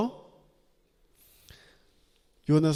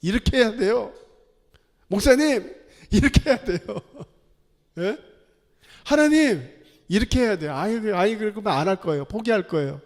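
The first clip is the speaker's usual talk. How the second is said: mock obstinate complainer, raised and loud, easing off; stubborn insistence; 4 semitones higher, 4 dB louder than usual. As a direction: act out a pig-headed protest with some laughter. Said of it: "얼마나 고집이 센지 몰라요". (고집부리듯 높은 목소리로 떼쓰기)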